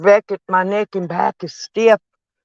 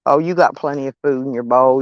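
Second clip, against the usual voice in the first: ordinary talk, nothing marked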